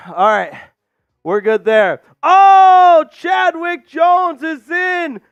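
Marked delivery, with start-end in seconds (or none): 2.2-5.2 a high, loud, drawn-out shout of delight; excited shout. Real, not acted. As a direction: burst out at the surprise guest. Star player joins the stream.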